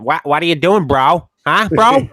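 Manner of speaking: hammed-up Boston bro challenge; Boston tough guy. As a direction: pick a fight like a Boston local. Mock Boston confrontation.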